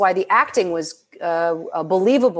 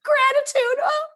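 high-pitched mock sobbing with gratitude; happy cry; much slower than usual. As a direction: wail it, overcome by joy. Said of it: "tell her about the happy cry".

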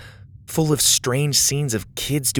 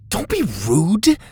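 scolding; 9 semitones higher than usual. Acted. Lydia scolding Dallas.

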